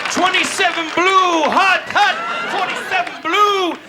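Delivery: high, drawn-out field call; quarterback signal call. Acted. Comic imitating football players on the field, quarterback signal call. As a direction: call the snap count across a field. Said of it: "That's them football players".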